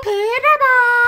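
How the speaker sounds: very high, slow, loud squawk; parrot voice. Retold or imitated. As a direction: cry the name like a parrot. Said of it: "he says Panama in the same".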